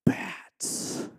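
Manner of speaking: breathless whisper of a warning; one word hissed in the dark cave; hushed. Retold or imitated. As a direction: whisper it so the bats don't wake. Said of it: "And Sean's like".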